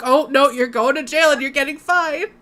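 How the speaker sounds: usual speaking voice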